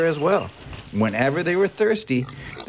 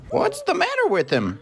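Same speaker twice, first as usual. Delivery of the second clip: very high-pitched deer voice; asking deer; 14 semitones higher than usual. A narrator's reading. Deer asking the other animals about the crocodile, high-voiced. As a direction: ask in a little high storybook animal voice. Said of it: "asked a deer".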